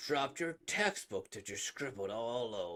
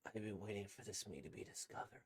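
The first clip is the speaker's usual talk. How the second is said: normal talking voice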